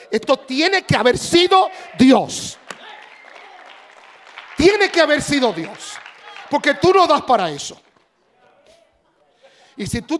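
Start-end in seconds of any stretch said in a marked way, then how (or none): 0.1-7.8 loud declaration of God's work; proclaiming shout. Acted. People marveling at the pastor, loud proclaiming shout. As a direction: shout it as undeniable proof.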